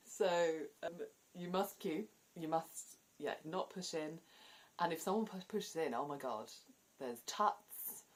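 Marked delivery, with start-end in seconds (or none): none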